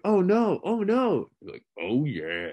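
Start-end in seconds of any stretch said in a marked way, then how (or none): 0.0-1.3 high, singsong cry of dismay; mock alarmed setup. Imitating kids in the cafeteria, high mock dismay. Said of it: "someone would be like". 1.8-2.5 low mascot catchphrase, imitated; Kool-Aid Man. Imitating the friend's cafeteria reply, low mascot voice. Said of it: "I could do the Kool-Aid Man too".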